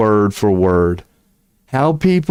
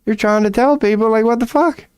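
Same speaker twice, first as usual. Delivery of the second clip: sarcastic mock reply; 9 semitones higher than usual. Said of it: "I'd be like".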